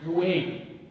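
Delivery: ordinary talk, nothing marked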